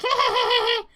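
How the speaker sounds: high, cackling gremlin hey; gremlin cackle. Acted. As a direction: laugh at them like a gremlin. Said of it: "The gremlins start cackling".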